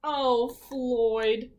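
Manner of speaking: normal talking voice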